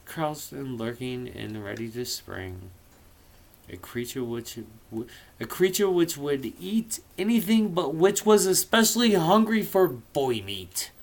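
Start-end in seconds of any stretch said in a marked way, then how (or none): none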